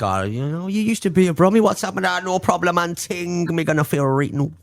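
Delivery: hammed-up Jamaican patois; mock Jamaican accent. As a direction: put on a comic Jamaican accent. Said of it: "wake up with a Jamaican accent".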